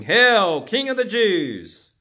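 loud, high mock hail; mocking proclamation. A narrator's reading. Soldiers kneel before Jesus, loudly mocking him. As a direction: proclaim it grandly in mockery. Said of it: "began to mock him ... and proclaiming".

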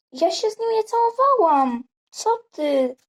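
angry denial; indignant denial. Acted. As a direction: fire back the denial. Accused girl denies kissing.

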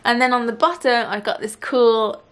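finger holding lip; speaking with lip stretched. Real, not acted. Seen 0: Finger pulls lower lip down showing braces, until 1.6s.

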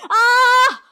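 high, piercing, sustained scream; loud scream. A narrator's reading. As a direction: scream out suddenly at full volume. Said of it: "screamed Rama".

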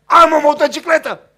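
put-on loud, high child's shout of joy; boy bragging to the neighbourhood kids, shouting happily; excited shout. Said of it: "i-a strâns pe toți și făcea".

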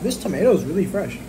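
talking while chewing; speaking between bites; mouth full. Real, not acted. Seen 0: Bite taken at -7; closed-mouth chewing continues into early words.

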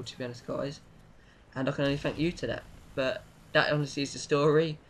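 normal talking voice

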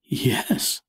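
ordinary delivery, nothing marked

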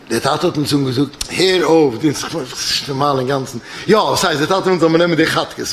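plain reading voice

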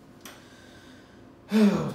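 big loud theatrical sigh; dramatic sigh. Acted. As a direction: heave an overdone sigh.

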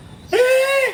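high mock squeal, then drawn out; hammed-up high, slow ticklish protest; imitating ticklish reaction. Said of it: "พอเสียงเผลอเสียงต่ำเนี่ยปุ๊บเขาจะแบบ".

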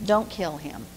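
soft, low, firm no; a hushed warning not to strike; quiet firm refusal. Acted. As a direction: hush him down, gently but firm.

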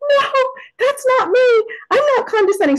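very high, squealed denial; mock horrified protest. Retold shocked reaction to criticism.

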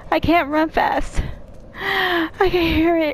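high, frightened pleading; panicking gamer. Real, not acted. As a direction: beg in real panic. Being chased by monster.

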